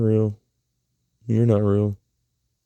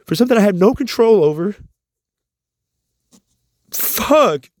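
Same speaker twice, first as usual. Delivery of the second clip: real anguished anger; 11 semitones higher than usual. Real, not acted. Sick man venting to viewers about a mean doctor, higher anguished anger. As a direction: pour out hurt fury at being dismissed.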